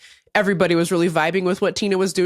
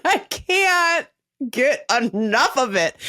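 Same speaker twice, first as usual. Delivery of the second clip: laughing; 10 semitones higher, slower than usual. Real, not acted. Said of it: "laughing while talking".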